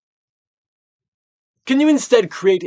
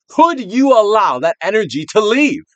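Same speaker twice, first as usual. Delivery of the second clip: loud coaxing; 10 semitones higher, 11 dB louder than usual. Acted. Urging release.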